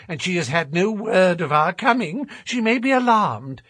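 high-pitched, quick-talking little man; Sholto's character voice. A narrator's reading. Man explaining the housekeeper's room to companions, high chattering voice. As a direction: pitch it up for a fussy, chattering gentleman.

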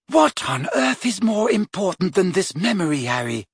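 high-pitched insistent question; incredulous. A narrator's reading. Girl urges friend to use the potion, incredulous. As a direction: press the point in disbelief.

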